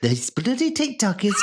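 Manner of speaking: high-pitched mock rant; hammed-up high shout; silly high outburst. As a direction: squeal it in comic outrage. Escalating the silly voice for laughs.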